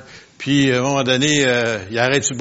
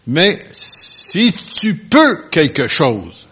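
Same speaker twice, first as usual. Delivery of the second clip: slow, drawn out; 4 semitones higher, 4 dB louder, much slower than usual. Shown as a demonstration. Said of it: "écoutez bien ça".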